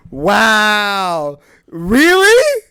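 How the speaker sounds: loud, hammed-up offended comeback; indignant outrage. [name] turns on her accusing brother, indignant. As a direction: push back loudly as if wrongly accused.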